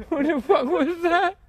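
high, loud, slow panicked question; real fright at a noise; drawn-out frightened outburst; scared shout. Real, not acted. Reacting to the knock in fright.

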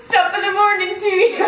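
high, squealed mock-Irish greeting; fake Irish accent. Trying Irish for a friend while cracking up, fake Irish accent. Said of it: "Let's do Irish".

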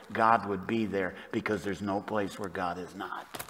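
ordinary delivery

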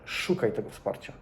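soft, breathy whisper of advice; hushed insistent whisper to a friend; whispered urging. Shown as a demonstration. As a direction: lean in and whisper it like a secret. Repeated advice, whispered take.

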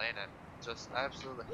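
ordinary talk, nothing marked

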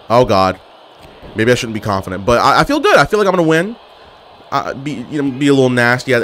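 ordinary delivery

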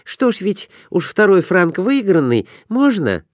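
put-on high, girlish voice; eager wife asking to place a bet; young wife's voice. A narrator's reading.

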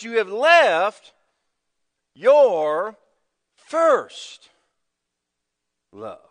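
you have left your first love, slow, each word weighted; weighted slow delivery, left and first hit; slow emphatic. Shown as a demonstration. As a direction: lay each word down slowly. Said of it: "underline the word left".

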